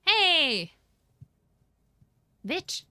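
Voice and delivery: sharp, high, loud protest; indignant shout. Acted. Traveler shouting back at the Inquisitor, loud and high. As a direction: yell it like you've just been sentenced.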